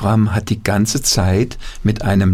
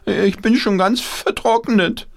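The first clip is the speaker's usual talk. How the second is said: high, slow, forlorn puppet voice; squeaky puppet voice; 11 semitones higher than usual. Acted. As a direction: lament it as a dried-up puppet. Puppet voice in story.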